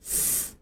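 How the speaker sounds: "S" as a bare unvoiced hiss; "S" as the final hiss of the letter S. Shown as a demonstration. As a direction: demo hiss "S" for learners. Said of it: "和字母S的尾音是发音一样的".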